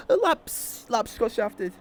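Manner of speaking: high voice breaking with laughter; laughing. Real, not acted. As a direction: struggle to get it out while laughing. Talking through laughter.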